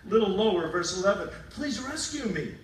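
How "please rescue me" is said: high, loud plea to God; Jacob pleading. Retold or imitated. Jacob praying to God for rescue, loud and pleading. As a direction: pray it out loud and desperate, higher. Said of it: "Then Jacob prayed".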